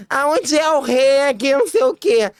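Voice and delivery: aguda e um pouco mais alta, devagar; pergunta aguda. Retold or imitated. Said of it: "eu querendo ler rápido". (pergunta aguda e lenta de quem está aflita)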